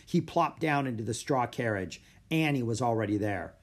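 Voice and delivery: ordinary delivery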